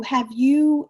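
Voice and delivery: ordinary talk, nothing marked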